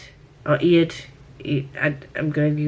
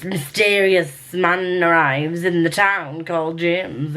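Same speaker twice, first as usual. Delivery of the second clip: ordinary delivery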